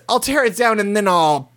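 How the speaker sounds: high-pitched hammed-up rant, peaking mid-way; mock screaming. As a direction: imitate a customer turning a threat into a frantic promise. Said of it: "he's immediately screaming at the owner".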